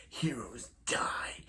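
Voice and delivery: slow, menacing whisper. Retold or imitated. Zoom telling Barry that heroes die, whispered slowly. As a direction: breathe it out like a threat.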